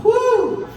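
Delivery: woo. Real, not acted. Comic hypes himself on stage.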